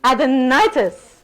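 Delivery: "Adenitis" slow, NI thrown out with a flourish; showy stress on NI. Shown as a demonstration. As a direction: give the NI of "Adenitis" jazz hands. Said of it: "We're gonna jazz hands the ni".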